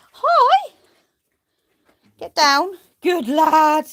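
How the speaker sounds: very high, loud; command and praise. Real, not acted. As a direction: train him. Training the sheep.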